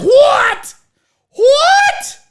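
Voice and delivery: piercingly high shouted shock; screeched mock disbelief, full volume; Aunt Diane. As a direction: imitate a very loud aunt, shrieking. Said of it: "she was the loudest person I've ever met".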